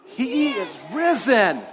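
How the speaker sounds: loud, joyful proclamation. Shown as a demonstration. Pastor leading kids in Easter call, loud and slow. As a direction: proclaim it like a shout of joy. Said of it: "you're gonna do your part".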